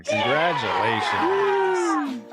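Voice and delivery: high, drawn-out congratulations; celebratory cheer. Pretend. Host announcing guest's promotion, celebratory.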